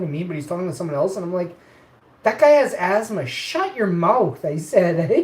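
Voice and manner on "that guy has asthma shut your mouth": angry. Retold or imitated. Telling the coach off.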